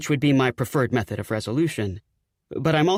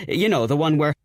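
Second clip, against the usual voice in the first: ordinary delivery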